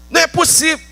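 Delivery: high, disbelieving outburst; incredulous protest. Acted. Peter's incredulous reply to Jesus.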